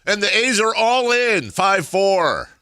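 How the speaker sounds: big slow announcer home run call. Retold or imitated. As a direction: belt it out like play-by-play. Mimicking the team's announcer.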